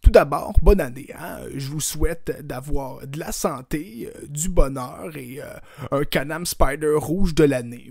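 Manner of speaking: loud, pompous premier voice; booming politician answering a comedian; Legault impression. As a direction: play the premier hamming it up.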